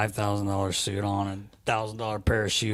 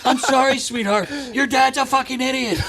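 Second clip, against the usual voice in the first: high, laughing sarcastic sympathy; mock apology with a laugh; 12 semitones higher than usual. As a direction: apologize to the girl while laughing at her dad. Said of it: "And he goes".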